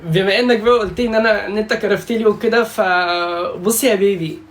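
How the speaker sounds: high, put-on teasing sweetness; mock flirty voice. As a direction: drag out "يا بيبي" like a flirt. Mocking flirty voice at rival.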